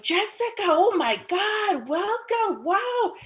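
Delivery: high, drawn-out gushing greeting; gushing welcome. Retold or imitated. Acquaintances welcoming the newcomer at the event, gushing. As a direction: imitate excited greeters, stretched and high-pitched.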